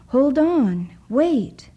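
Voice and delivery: loud, high alarmed cry to the dock; panicked shout. A narrator's reading. Boy stranded on the boat shouts to his family, panicked. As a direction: call out in sudden panic. Douglas trapped on departing boat.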